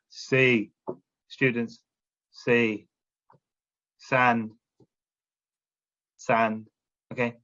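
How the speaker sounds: deliberately dull classroom drill; boring drill. Shown as a demonstration. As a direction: drone the words like a tired teacher. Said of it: "This is boring".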